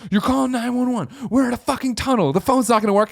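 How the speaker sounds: high-pitched mock rant, not full volume; imitated screaming. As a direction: imitate an irate passenger scoffing at a pointless call. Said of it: "the guy who'd been screaming".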